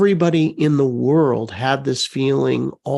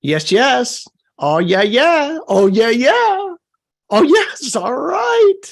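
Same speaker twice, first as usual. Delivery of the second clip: excited; 9 semitones higher, 4 dB louder, slower than usual. Real, not acted. Reacting to the chat with joy.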